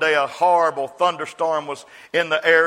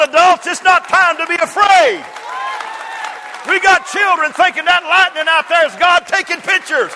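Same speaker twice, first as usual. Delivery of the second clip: much higher and louder shouted preaching; preaching at full force; 13 semitones higher, 7 dB louder than usual. Acted. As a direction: shout it to the whole church, full fire. Preacher erupts after the child story.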